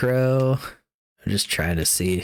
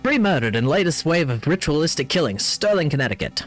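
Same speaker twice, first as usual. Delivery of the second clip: news read; 4 semitones higher than usual. Acted. Reads in-game newspaper article.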